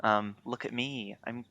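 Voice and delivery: ordinary delivery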